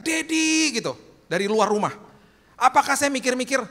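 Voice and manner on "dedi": put-on high, louder child's cry for dad; child calling out. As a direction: call out for daddy from outside. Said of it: "dia teriak".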